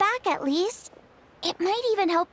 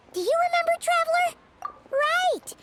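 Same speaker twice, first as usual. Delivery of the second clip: high-pitched, excited reminder; eager prompting; 8 semitones higher than usual. Acted. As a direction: chirp it eagerly, pitched well up. Mascot eagerly prompts the Traveler.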